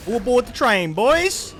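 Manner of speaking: loud, high gleeful call to his teammates; excited shout. Real, not acted. Streamer cheering his team on, with a loud excited shout. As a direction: hype it up like a train conductor. Gleeful gamer calling out a kill.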